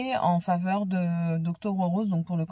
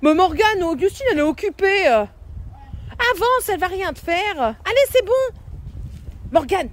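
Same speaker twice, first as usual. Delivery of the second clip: calling out encouragingly; 10 semitones higher, 6 dB louder than usual. Real, not acted. Coaxing child past the hen.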